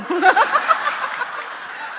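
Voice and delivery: loud, high burst of real laughing; long hearty laugh at own joke; laughter. Real, not acted. Laughs after bee joke.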